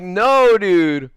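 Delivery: high, loud emphatic denial; acted-out loud protest; retold denial. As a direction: protest it, pitch jumping up then falling.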